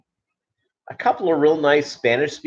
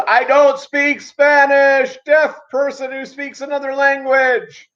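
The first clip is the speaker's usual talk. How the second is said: mocking shout; 12 semitones higher, 3 dB louder than usual. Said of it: "he again goes".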